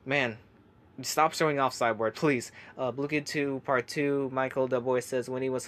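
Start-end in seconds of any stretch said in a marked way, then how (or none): none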